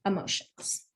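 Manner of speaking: soft unvoiced word while signing; whispering the vocabulary word to the class; whispered sign word. Shown as a demonstration. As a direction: breathe the word out as you sign. Sign teacher voicing the sign word.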